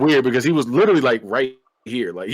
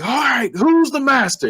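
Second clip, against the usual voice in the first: high mock challenge; villain's demand; 11 semitones higher than usual. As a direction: taunt like the movie villain, pitched up. Retold villain demanding submission.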